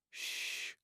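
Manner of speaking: soft breathy shush; father quieting his sobbing daughter; hushing. Acted. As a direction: hush her gently like a child.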